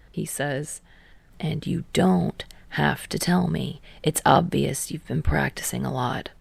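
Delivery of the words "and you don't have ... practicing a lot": a lightly lower, dry teammate voice; Kei, dry. A narrator's reading. Kei dryly teasing Hinata about practice, slightly lower voice. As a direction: keep it cool and teasing.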